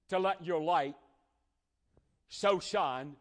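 loud, high, driving sermon voice; preaching at full force. Acted. Preacher urging church to keep their light clean. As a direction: press the point hard on the flock.

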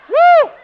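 high, very loud "woo"; acted-out ecstatic church holler; spirited whoop. Neighbor caught up in the spirit, whooping. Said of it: "I got in the spirit".

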